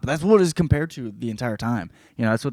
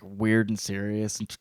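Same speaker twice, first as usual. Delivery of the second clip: normal talking voice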